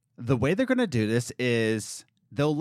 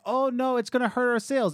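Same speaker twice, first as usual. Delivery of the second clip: higher-pitched mock alarm; mock worry; 10 semitones higher than usual. Host mimics management fearing lost sales, mock-worried. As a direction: imitate fretting bosses, pitched well up.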